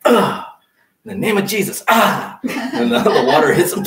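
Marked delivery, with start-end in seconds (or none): 0.1-2.4 higher, louder hammed-up priest blessing; mock priest. Preacher mocks the priest at infant baptism, loud and high. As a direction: ham up a priest splashing the baby. Mimicking priest sprinkling baby.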